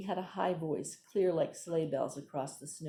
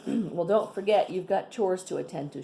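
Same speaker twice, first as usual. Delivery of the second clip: firm, louder warning; sterner tone; 2 semitones higher, 7 dB louder than usual. A narrator's reading. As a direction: scold him about chores. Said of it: "continued in a sterner tone".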